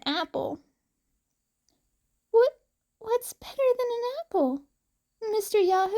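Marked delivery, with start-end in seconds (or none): 2.3-5.9 put-on high, quick, curious little girl; a small child asking eagerly; little girl Eri.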